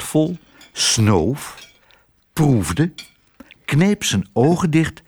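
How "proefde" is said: plain reading voice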